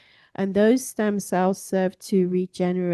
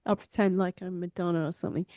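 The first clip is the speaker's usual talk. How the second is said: ordinary talk, nothing marked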